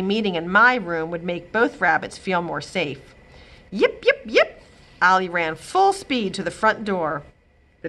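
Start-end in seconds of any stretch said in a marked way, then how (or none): 3.7-4.6 high, sharp little dog yips. Acted. Small dog yipping as the doorbell rings, excited yips.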